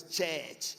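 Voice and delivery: "church" as said in Ghana, with an e-like vowel; Ghanaian-style "church"; Ghanaian pronunciation. Shown as a demonstration. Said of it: "You go to Ghana".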